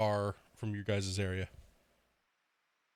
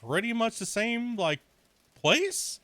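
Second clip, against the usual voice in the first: much higher, puzzled, rising to the end; baffled question; 10 semitones higher than usual. Retold or imitated. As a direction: ask the two players in disbelief. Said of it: "I was like".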